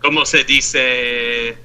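very slow Spanish como se dice; mock Spanish. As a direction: play along in drawn-out Spanish. Spanish echo of how you say.

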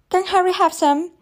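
exasperated high-pitched mock child; imitating a child's pestering question; mimicking a child. A narrator's reading. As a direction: parrot the little girl's plea. Mother mimics the child's constant questions.

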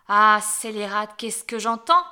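loud, slow furious cry; furious witch. A narrator's reading. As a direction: explode in outrage at the girl. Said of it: "s'exclama la sorcière".

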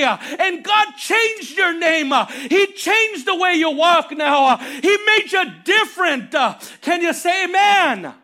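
loud, driving sermon climax; full-force preaching. Real, not acted. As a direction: preach it hard, punching each phrase with "uh" between.

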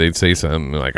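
ordinary talk, nothing marked

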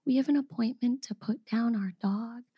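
a hint of a sad hush. Couple telling the receptionist they must put the dog down, softly. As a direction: say it softly, like bad news.

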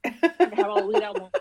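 amused protest breaking into laughs; laughing. Real, not acted. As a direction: say it through laughter. Joking retort, both hosts laughing.

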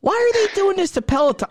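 high-pitched, fast outburst of dismay; appalled disbelief. Real, not acted. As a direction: squawk it in horrified disbelief. Appalled by news he is reading.

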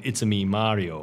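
a hint of game-character catchphrase; lightly voiced Mario. Co-host quotes the game to settle it, light character imitation. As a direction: lightly imitate the game's hero.

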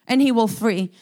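ordinary delivery

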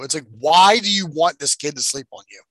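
usual speaking voice

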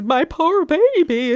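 high, loud, drawn-out mock sympathy; doting mom imitation. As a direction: coo it loudly like an over-dramatic mother. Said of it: "She immediately changed her entire tune".

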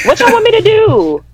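high-pitched exasperated wail; whiny. Real, not acted. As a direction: cry out in mock despair. Exasperated at being teased.

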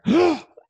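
loud gasping breath in; a big demo gasp at the top of the breath; sharp inhale. Shown as a demonstration. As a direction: suck in the last bit of air. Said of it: "push in a little more air".

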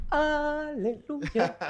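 high, very drawn-out playful praise; playful long-held hallelujah; mock hallelujah. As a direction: stretch it out like a hymn, softly and high. Mock praise after the lucky turn.

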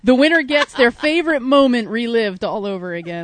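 normal talking voice

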